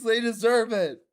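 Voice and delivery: loud, high insistence; shouted insistence at the game. Real, not acted. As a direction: yell it out. Continuing the yelled demand.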